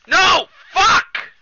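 high, very loud cry of dismay; frustrated shout. Real, not acted. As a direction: yell out in sudden frustration. Game goes wrong.

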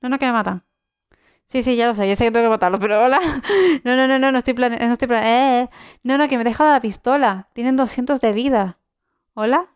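flustered, rushed panic under attack; panicking gamer. Real, not acted. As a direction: babble nervously, caught off guard. (jugador en pánico)

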